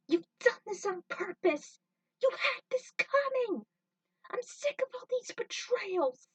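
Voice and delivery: high, loud, tearful rage at a betrayer; furious yell. Acted. Trudy screaming at Jet over his betrayal, furious and tearful. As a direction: scream it through tears of rage.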